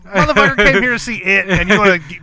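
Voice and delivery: loud, high, heated complaint; father venting about the usher, loud and high; exasperated rant. Retold or imitated. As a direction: vent at the absurdity full force. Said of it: "I was like".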